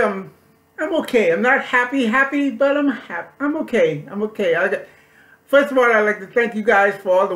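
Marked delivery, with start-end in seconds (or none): none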